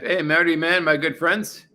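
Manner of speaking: ordinary talk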